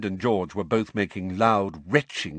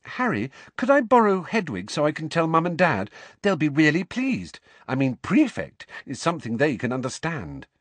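lightly voiced girl, pitched up; eager, careful girl; 8 semitones higher than usual. A narrator's reading. Girl asking friend to borrow his owl, eager. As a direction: ask a favour with eager politeness.

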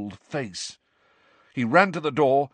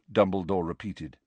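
plain reading voice

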